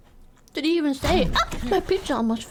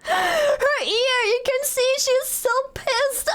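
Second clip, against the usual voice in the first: higher, laughing through the words; barely holding the laugh in; 5 semitones higher, slower than usual. Real, not acted. Streamer cracking up over an angry cat picture, laughing.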